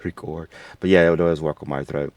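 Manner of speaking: ordinary delivery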